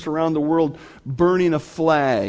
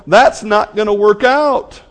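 loud, slow, raised declaration; emphatic realization; 4 semitones higher, 8 dB louder than usual. Acted. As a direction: drive each word home forcefully. Voices the sinner's emphatic realization.

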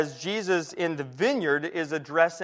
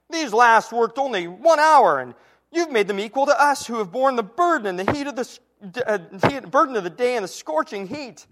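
grumbling complaint; 5 semitones higher, 5 dB louder than usual. Acted. Laborers grumbling at the master, loud aggrieved protest. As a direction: voice the workers' indignant grumble.

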